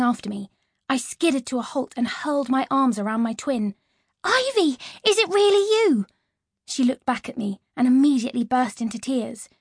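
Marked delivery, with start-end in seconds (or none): 4.2-6.1 overjoyed disbelief. Acted. Reunion with lost twin sister.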